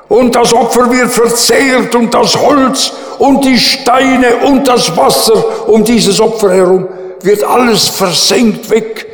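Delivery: high, loud, fervent preaching; preacher proclaiming the fire from heaven, high and loud; preaching at full force. Acted. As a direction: build the miracle with fervour. Preaching climax, fire falls.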